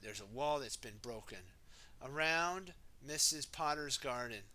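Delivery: plain reading voice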